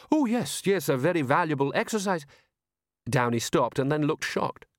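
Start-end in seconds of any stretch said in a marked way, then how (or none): 0.1-2.2 flustered agreement. A narrator's reading. Downey hastily agreeing.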